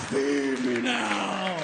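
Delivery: low, slow, loud demand; hungry plant growl. Acted. Plant monster demanding food from Seymour, low and slow. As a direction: growl it like a man-eating plant.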